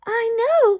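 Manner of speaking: the Who mayor calling up proudly; tiny mayor calling. Acted. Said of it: "called the voice".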